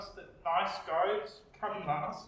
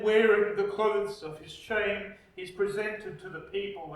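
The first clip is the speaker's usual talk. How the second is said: ordinary delivery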